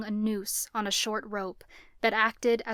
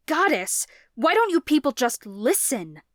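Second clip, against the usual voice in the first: exasperated outburst; 5 semitones higher, 8 dB louder than usual. A narrator's reading. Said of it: "his mother gasped".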